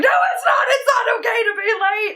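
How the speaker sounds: hammed-up panicked cry about being late; mock tantrum wail. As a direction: wail it like a child throwing a tantrum. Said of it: "And I'm like".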